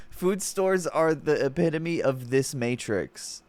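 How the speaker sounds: ordinary delivery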